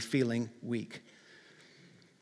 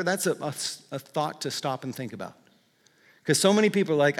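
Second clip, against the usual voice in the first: usual speaking voice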